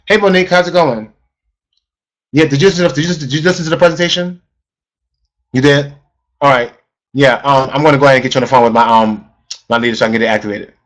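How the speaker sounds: low, serious, unsmiling phone talk; frowning voice. Shown as a demonstration. Coach demonstrating to the team a frowning, low phone call. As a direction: sound like you're frowning, flat-out serious. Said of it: "did anybody notice my voice tone change".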